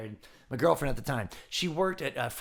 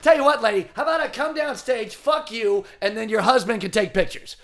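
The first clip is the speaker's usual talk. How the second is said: loud, raised snap at the heckler; angry comeback; 6 semitones higher, 11 dB louder than usual. Retold or imitated. Richard Belzer answering the heckling lady, loud angry snap. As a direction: bark it down at her.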